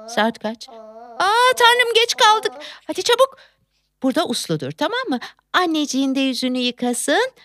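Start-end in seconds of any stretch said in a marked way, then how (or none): 1.2-3.4 alarmed. Acted. Realizes they are late.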